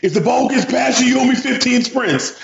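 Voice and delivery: high, harsh coach-dad yell; retold yelling dad. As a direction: bark it like a pushy sports dad. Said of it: "this dude is yelling at his kids".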